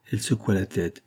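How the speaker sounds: ordinary delivery, nothing marked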